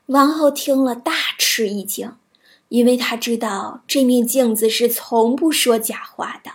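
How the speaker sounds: plain reading voice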